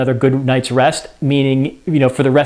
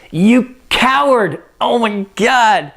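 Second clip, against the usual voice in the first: higher, slow scorn for a coward; higher mocking wife's voice; scornful Lady Macbeth; 8 semitones higher, slower than usual. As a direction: sneer it down at him, slowly.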